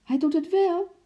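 very high, loud insistence; excited call. A narrator's reading. Lotje contradicting Henkie, excited shout. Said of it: "riep Lotje opgewonden".

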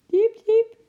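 very high, loud cheeps; squeaking squirrel. A narrator's reading. Squirrel wants attention after a long day, piercing squeaks. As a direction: squeak like a small animal demanding notice. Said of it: "piepte Eki".

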